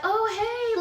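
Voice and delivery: high-pitched bubbly greeting; bubbly, sweet. Retold or imitated. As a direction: imitate a sweet, gushing hello way up high. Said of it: "really bubbly, really sweet".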